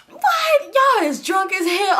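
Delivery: high, loud, drawn-out imitation; mock shouted denial. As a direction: imitate him yelling in exaggerated disbelief. Acts his loud denial.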